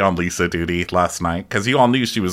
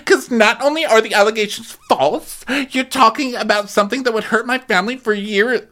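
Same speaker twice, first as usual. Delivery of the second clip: much higher, fast indignant voice; 13 semitones higher than usual. Retold or imitated. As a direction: rattle off outraged self-defence. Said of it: "And Jen tells us".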